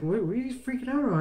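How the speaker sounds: high, quick, baffled question; puzzled wife. Retold or imitated. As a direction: quote the wife's puzzled surprise, fast and high. Wife asks why he's excited.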